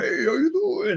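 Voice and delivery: high, mock-cheery greeting; imitating a too-friendly guy; cheery gallery guy. Gallery guy poking his tummy, mock cheery. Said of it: "starts going".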